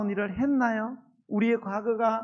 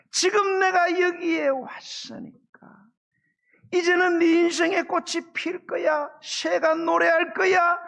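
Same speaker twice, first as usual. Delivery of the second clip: high, loud, tearful pleading; tearful preaching shout; 10 semitones higher, 5 dB louder, slower than usual. Acted. Pastor urging the downcast flock to rise, in a high tearful shout. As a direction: cry it out to the back row, voice near breaking.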